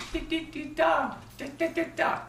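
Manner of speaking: high, rhythmic ticks ending in tock; imitated code signal. Shown as a demonstration. Veteran demonstrates the signal for V, imitated code. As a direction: tap out code with the voice.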